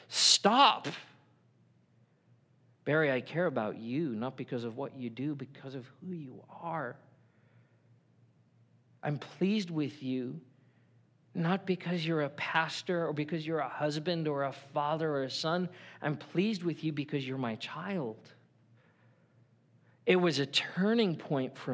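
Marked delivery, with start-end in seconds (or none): 0.1-0.9 firm stop. Acted. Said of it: "And God goes". 2.8-18.3 tender voice of God. Acted. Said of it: "And God goes".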